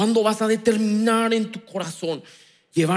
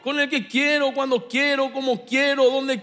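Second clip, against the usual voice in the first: raised, commanding voice of God over music; God speaking; 8 semitones higher than usual. Acted. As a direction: declare it as God himself.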